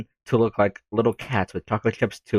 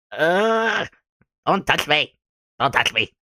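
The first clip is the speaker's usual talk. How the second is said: a hint of a bratty girl snapping; mock snapping; 5 semitones higher, slower than usual. Natsuki pushing off help after falling, snappish. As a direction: push away the helper, irritated.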